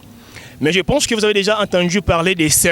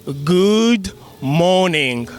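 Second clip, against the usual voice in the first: slow model; much slower than usual. Shown as a demonstration. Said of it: "teaching the greeting".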